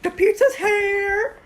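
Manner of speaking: loud, high cheering; excited shout. Real, not acted. Friends celebrating the pizza arriving, loud whoops.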